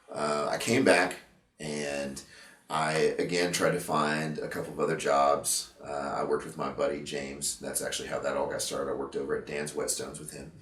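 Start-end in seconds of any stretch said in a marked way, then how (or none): none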